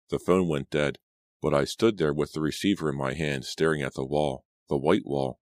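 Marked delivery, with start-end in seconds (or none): none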